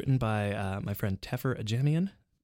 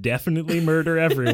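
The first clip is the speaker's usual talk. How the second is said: mock voice of God; 7 semitones higher, 9 dB louder than usual. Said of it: "And then God said".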